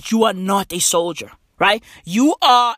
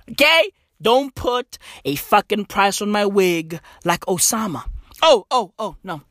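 ordinary delivery

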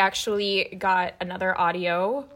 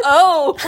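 laughing burst; 6 semitones higher, 7 dB louder than usual. Real, not acted. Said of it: "that really tickled you".

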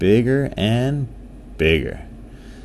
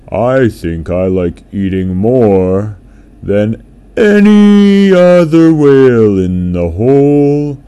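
loud, slow, drawn-out boast; blue whale boasting; 3 semitones higher, 8 dB louder, much slower than usual. A narrator's reading. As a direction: brag grandly about loving food. Whale boasts about eating.

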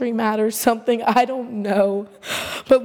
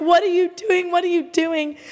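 alarmed call; 7 semitones higher than usual. Retold or imitated. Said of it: "I'm like".

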